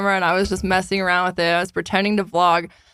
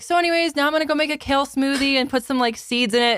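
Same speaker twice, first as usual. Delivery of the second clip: higher, breezy influencer voice; mock influencer vlog; 5 semitones higher than usual. Friend pretending to vlog to prank her boyfriend, influencer voice. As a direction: play a fake fitness vlogger. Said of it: "pretending to be an influencer".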